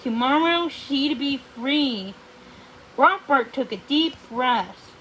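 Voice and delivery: plain reading voice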